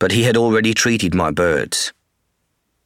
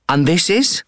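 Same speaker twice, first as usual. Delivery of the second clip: much higher, friendly woman's voice; woman vet voice; 10 semitones higher than usual. Acted. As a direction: voice the vet asking about the bird. Woman vet's voice.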